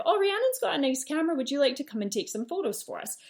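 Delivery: high and fast, a light imitation of an excited friend asking a favour; lightly voiced gushing friend, quick and high; mock eager friend. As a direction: toss it off as a chirpy friend, quick and high-pitched. Friends asking her to take photos.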